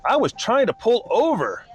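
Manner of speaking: high-pitched, drawn-out mock yell. A narrator's reading. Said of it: "began yelling".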